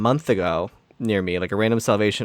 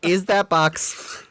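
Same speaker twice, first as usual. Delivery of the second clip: exasperated politeness; 5 semitones higher than usual. Retold or imitated. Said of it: "And I was like".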